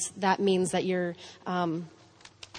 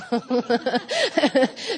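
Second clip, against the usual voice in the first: laugh; 6 semitones higher, 5 dB louder than usual. Real, not acted. Laughs at not being gentle.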